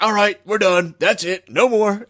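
brusque wrap-up. Retold or imitated. Grandfather done with the funeral, lightly imitated brusque dismissal. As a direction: declare it finished, imitating an old relative.

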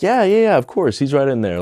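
high, bright and eager yes-yes-yes; eager receptionist. Retold or imitated. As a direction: chirp it like a keen front desk lady. Front desk lady answers.

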